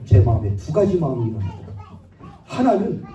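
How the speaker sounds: normal talking voice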